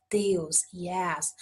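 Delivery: ordinary talk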